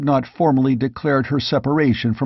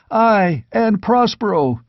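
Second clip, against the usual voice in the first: eager shout; 8 semitones higher than usual. A narrator's reading. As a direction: shout it over the crowd. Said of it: "shouted another".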